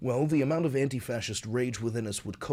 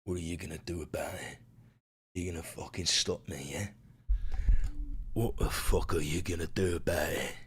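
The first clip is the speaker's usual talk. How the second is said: low, quiet menace; soft, low taunt from a cocky intruder; swaggering tough pet; 9 semitones lower, 5 dB quieter than usual. Acted. Beans strutting in on Luigi, low quiet taunting. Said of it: "And he's just like".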